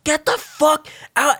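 high, slow, drawn-out order; panicked yell. Retold or imitated. To his hurt friend in the smoking car, frantic shout. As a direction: yell at him to get out now.

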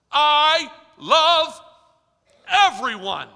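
loud, ringing declaration; loud proclamation. Acted. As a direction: proclaim it to the whole church. God's words, proclaimed.

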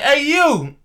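retold high, loud shout after someone; insistent calling out. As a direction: imitate a man hailing someone walking off. Retelling man hailing courier.

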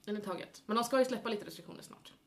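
ordinary delivery

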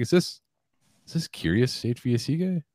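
very high, tentative imitation of a caller; imitated curious fan. Supply house guy recognizing a caller's voice, imitated high and curious. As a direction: pitch it way up, as if checking a hunch.

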